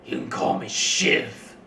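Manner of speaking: loud, rasped self-introduction; dramatic sulky rogue. Acted. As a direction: announce yourself with brooding menace. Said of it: "stabs a knife into the table very dramatically and says".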